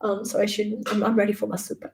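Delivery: usual speaking voice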